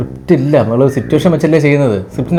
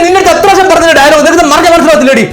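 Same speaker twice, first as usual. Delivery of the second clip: high-pitched, raised-voice tirade; exasperated director ranting; furious outburst; 12 semitones higher, 4 dB louder than usual. Acted. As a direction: berate the actors at full tilt, patience gone. Director blows up at forgetful actors.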